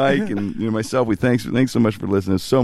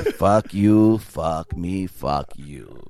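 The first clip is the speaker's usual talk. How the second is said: gruff quote; 6 semitones lower, much slower than usual. Retold or imitated. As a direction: growl it out, sinking low. Quoting a gruff character.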